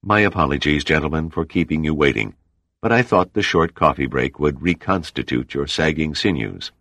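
plain reading voice

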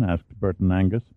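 usual speaking voice

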